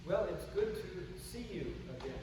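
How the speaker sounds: ordinary talk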